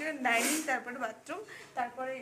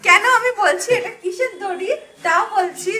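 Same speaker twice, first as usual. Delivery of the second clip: laughing; 5 semitones higher, 7 dB louder than usual. Real, not acted. Teasing husband's habit, laughing.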